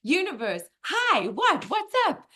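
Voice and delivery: eager high-pitched greeting, bursting with excitement; high, loud, giddy hello; excited little soul. Acted. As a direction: pipe up like a thrilled little soul. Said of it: "it's so excited".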